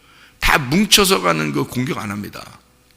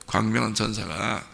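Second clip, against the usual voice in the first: "광명한 천사가" repeated softly, lower and quieter; quiet echo; 3 semitones lower, 6 dB quieter than usual. Shown as a demonstration. Pastor repeats the key phrase to the congregation, soft low echo. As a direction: drop the voice and echo "광명한 천사가" to let it sink in. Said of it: "광명한 천사가 우는 사자예요".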